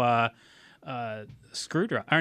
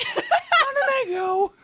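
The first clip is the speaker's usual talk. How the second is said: put-on child voice; 5 dB louder, much slower than usual. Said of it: "child voice".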